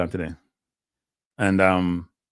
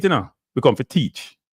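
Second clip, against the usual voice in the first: normal talking voice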